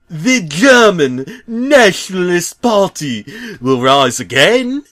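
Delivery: slow, loud, high ranting shout; raving yell. Acted. Bob raving at the crowd, in a slow loud high yell. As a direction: rant it like a fanatic. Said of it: "he yelled".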